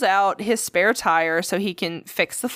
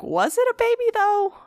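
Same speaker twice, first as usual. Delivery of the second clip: pitched way up, second-guessing; doubting himself; 13 semitones higher than usual. Retold or imitated. Pete asks himself about the baby the next morning, high and doubtful. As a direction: wonder aloud, voice lifted high. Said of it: "and he was like".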